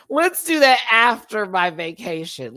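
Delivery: high, loud, through laughter; laughing. Real, not acted. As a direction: barely hold the laugh while pleading. Cracks up repeating reply to Patrick.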